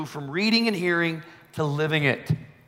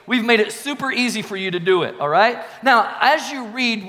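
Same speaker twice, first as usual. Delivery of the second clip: ordinary delivery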